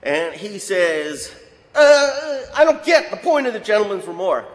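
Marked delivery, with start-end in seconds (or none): 1.7-4.4 confused. Retold or imitated. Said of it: "thoroughly confused".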